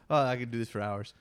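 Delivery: normal talking voice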